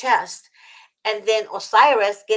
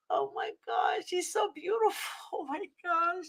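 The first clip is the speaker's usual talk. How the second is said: softer, breathy wonder at the cat; awed hush; 8 semitones higher, 11 dB quieter than usual. Real, not acted. Guest gazing at the cat, hushed and awed. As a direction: gush quietly in awe.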